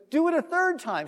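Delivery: high called-out order; acted command. Retells Elijah ordering more water.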